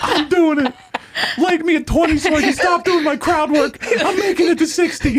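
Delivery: high, strained comic wail; hammed-up weepy outburst; mock crying. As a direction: wail it in desperate tearful defiance. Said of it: "You're crying like".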